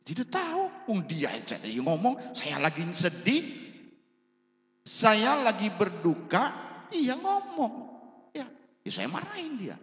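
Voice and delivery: high-pitched, aggrieved protest; acted indignant complaint. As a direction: imitate an upset mourner justifying her anger. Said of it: "Ibu marah-marah sama siapa".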